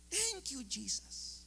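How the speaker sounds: hushed quote. Retold or imitated. Preacher repeating the woman's thanks in disbelief, hushed quote. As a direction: echo the praise under your breath.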